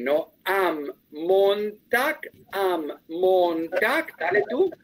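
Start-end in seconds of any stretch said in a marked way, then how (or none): 0.4-4.2 "Am Montag" very slow, M held; slow demo. Shown as a demonstration. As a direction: draw out "am" for the student. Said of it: "te acuerdas que yo le puse am den". ("am Montag" muy despacio)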